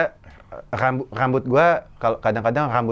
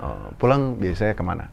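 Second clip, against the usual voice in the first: slightly deeper flirting voice; deepened voice; 7 semitones lower, 4 dB quieter than usual. Retold or imitated. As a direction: voice lowered to sound manly. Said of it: "suaranya gue berat-beratin".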